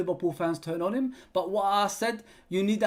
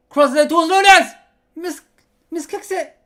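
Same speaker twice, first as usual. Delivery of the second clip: much higher, much louder rising call of the cross; excited commentary; 10 semitones higher, 13 dB louder than usual. Real, not acted. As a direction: call it like the ball is about to go in. Live play-by-play of a chance.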